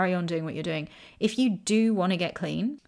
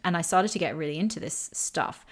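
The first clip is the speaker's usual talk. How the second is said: usual speaking voice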